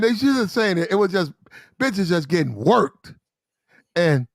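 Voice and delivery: normal talking voice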